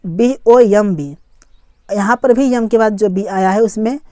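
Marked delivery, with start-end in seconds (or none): 0.1-1.2 slow, spaced letters; one letter at a time; spelling it out. Shown as a demonstration. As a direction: spell it slowly for learners. Spelling a word aloud for learners.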